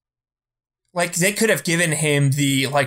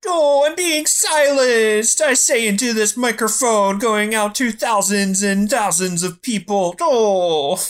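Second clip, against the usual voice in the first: much higher, slow whiny mock complaint; Bill Burr impression; 7 semitones higher, slower than usual. Host mocks comedian whining into microphone, whiny impression. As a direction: whine it like a pampered star crying censorship. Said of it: "That's a really good Bill Burr impression".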